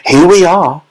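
high, excited call; Mister Wonka. A narrator's reading. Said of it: "cried Mister Wonka".

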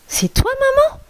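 very high, small questioning voice; high-pitched little one's voice; little kid goat. Acted. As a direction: ask like a tiny child at the door. Little kids ask through the door.

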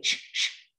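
"ش، ش" as short voiceless puffs; isolated letter sound. Shown as a demonstration. Teacher breaking down captured, separate "ش، ش" sounds. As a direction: sound out "ش، ش" without any vowel. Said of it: "اليو هنا بنطقها ايه؟".

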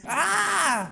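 high wailing "ah"; hammed-up scream. Child left alone in the storm, wailing scream. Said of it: "Can I stop screaming?".

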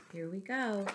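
ordinary delivery

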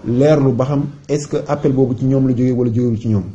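ordinary talk

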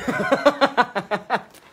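a burst of high laughter. Real, not acted. Laughing at his own joke.

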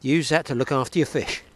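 ordinary talk, nothing marked

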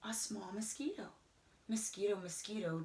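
ordinary delivery, nothing marked

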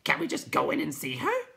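high, loud creature voice; pleading night hob. Acted. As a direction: ask it as an impatient little creature. Night hob asks, same voice.

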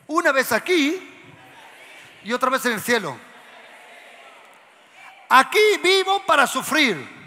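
loud, high proclaiming; loud preaching. Real, not acted. Preacher shouting to the congregation, loud and high. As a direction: call it out with full force.

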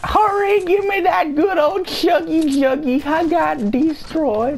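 high whiny voice. Real, not acted.

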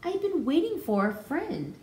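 sadly. A narrator's reading. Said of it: "she said sadly".

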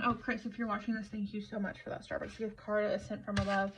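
usual speaking voice

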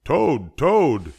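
high, loud call for a friend; shouting to rouse someone; excited shout. A narrator's reading. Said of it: "shouted Frog".

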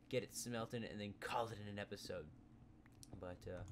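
ordinary talk

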